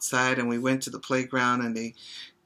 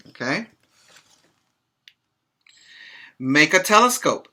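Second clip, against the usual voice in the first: bright, louder storybook read-aloud; reading to children; 3 semitones higher, 6 dB louder, slower than usual. Acted. Teacher reading a picture book to her pre-K class, lively read-aloud.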